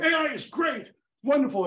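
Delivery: high, gushing TV pundit; mock cheerful pundit. A CNN pundit gushing that AI is great, mock gushing. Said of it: "this person going".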